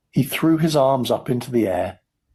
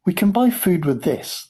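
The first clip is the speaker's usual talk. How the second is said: excited; 9 semitones higher than usual. A narrator's reading. Charlie voiced, excited thought.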